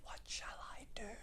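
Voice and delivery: helpless, voiceless rabbit whisper. A narrator's reading. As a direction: whisper it as if the throat is blocked. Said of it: "he whispered to a squirrel".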